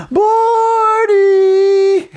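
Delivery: very high, far-carrying call of a name; distraught owner calling. Retold or imitated. Owner searching the trees, calling desperately for the lost parrot. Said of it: "and I'll never forget".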